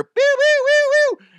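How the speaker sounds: very high, loud laser-like pews; imitated sound effects. Prank caller adds fake radio effects, squealed sound effects. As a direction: squeal out mock prize-show effects. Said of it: "we'd have, like, sound effects".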